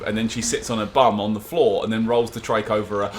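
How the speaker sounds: ordinary talk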